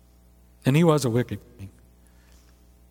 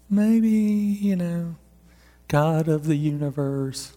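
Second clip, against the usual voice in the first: tempted musing; 2 semitones higher, slower than usual. Retold or imitated. Preacher voicing his own temptation to compromise, slow and hesitant. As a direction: drag it out, wavering. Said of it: "where I didn't think".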